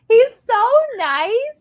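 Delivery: high, loud, slow sweet praise; mock sweet. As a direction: coo it sarcastically.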